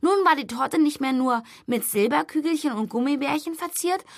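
plain reading voice

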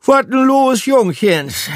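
high, loud sleepy Hamburg dialect; Hamburg accent. A narrator's reading. Pub mouse yawning, asking the lads what's up in Hamburg dialect.

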